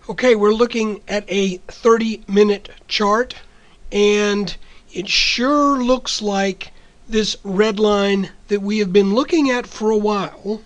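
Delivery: usual speaking voice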